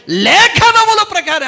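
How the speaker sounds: high, loud and slow, with a laugh; leading the answer; fervent slow proclamation. Real, not acted. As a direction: ring it out joyfully to the church. Preacher leads call and response.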